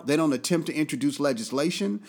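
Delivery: ordinary talk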